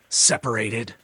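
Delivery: loud high outraged question. Retold or imitated. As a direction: fire it back in outrage. Outraged echo.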